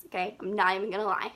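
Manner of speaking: normal talking voice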